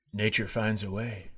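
a light, low, quiet Goldblum imitation; Jeff Goldblum. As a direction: murmur it like a lightly done Goldblum. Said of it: "Jeff Goldblum just said".

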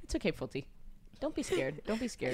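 soft, hushed comfort; gently calm a scared pet; soothing the cat. Real, not acted. Friend soothes frightened cat Fulty, very soft and tender.

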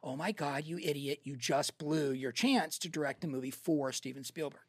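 low, subdued inner voice; quiet self-reproach. Retold or imitated. Director berates himself at home that night, quietly appalled.

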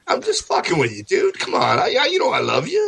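high mock reassurance; high teasing excuse. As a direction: coax it high and fast.

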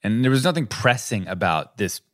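usual speaking voice

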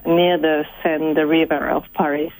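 ordinary talk, nothing marked

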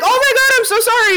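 high-pitched imitation of an apology; excited mock apology; retold flustered apology. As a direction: gush it out high, imitating him. Retells the musician apologising after collision.

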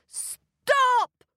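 high, loud shout to halt; boy's shout. A narrator's reading. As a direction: shout out in alarm as a boy. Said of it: "shouted the boy".

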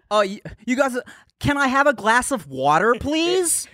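British person's exaggerated American accent; imitated American accent. Imitating Brits doing American voices, mock American accent. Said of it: "people with British accents do American accents".

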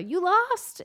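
high, loud teasing call; friend calling out. Retold or imitated. Old friend teasing from his truck, loud call. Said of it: "I hear".

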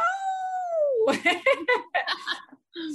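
a burst of high, loud laughter. Real, not acted. Laughing at her own realization.

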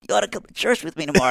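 quick, high invite from his buddy; lightly voiced buddy. Retelling a friend's church invite, quick and high. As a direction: rattle off the invite like a stoned friend. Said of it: "he's like".

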